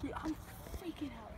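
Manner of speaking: real frightened whisper; whispered nervous panic; scared whisper. Real, not acted. Scared on the shed roof.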